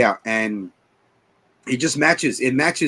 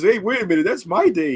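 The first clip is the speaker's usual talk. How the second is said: raised, higher mock objection; retold protest; 7 semitones higher, 4 dB louder than usual. Retelling the guy objecting over his date, raised mock protest. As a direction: object loudly, imitating an indignant guy.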